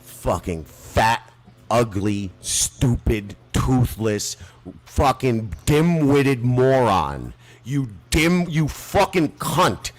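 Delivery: enraged host cursing out a rival; furious insults. Real, not acted. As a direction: pile the insults on one by one. Insult string at Lou.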